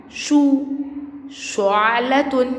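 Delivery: "shu" alone, then شعلة slowly as "shu'-la-tun"; drawn-out damma sound. Shown as a demonstration. Teacher shows the damma to first graders, drawn-out "shu" in شعلة. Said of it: "عليها ضمة".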